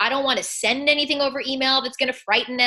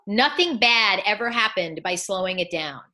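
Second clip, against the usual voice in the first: ordinary delivery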